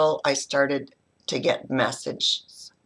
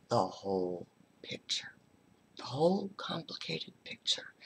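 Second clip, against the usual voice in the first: whispered, softer, in wonder; hushed awe; 10 dB quieter than usual. Real, not acted. To the audience, about seeing a client's whole picture, whispered. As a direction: breathe the words like a secret. Describing deep insight into client.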